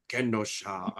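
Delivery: low-pitched local accent on "Kenosha"; correct local accent. Shown as a demonstration. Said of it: "say it with the correct accent".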